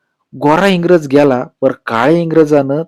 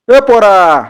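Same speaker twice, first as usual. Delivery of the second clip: loud, high shout across the market; calling out; 8 semitones higher, 5 dB louder, much slower than usual. Acted. Village oil-presser shouts to the boy across the market. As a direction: hail the boy from a distance.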